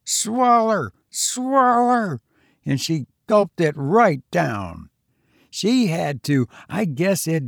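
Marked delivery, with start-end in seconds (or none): 0.0-2.2 hollering. A narrator's reading. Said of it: "I fairly hollered at her".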